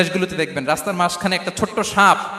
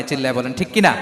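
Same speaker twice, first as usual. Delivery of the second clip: usual speaking voice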